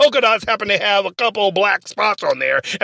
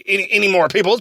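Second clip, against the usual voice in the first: ordinary delivery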